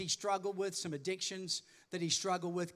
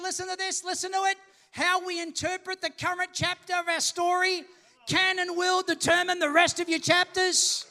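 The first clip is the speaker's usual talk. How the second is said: loud, high pulpit exhortation; full-force preaching; 10 semitones higher, 11 dB louder than usual. Real, not acted. Preacher urges congregation to respond to the point, full-force preaching. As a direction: ring out each phrase to rouse the room.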